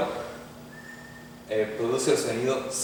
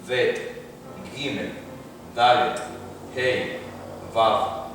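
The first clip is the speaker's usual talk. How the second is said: slow alphabet recital; much slower than usual. Shown as a demonstration. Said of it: "reciting alphabet for students".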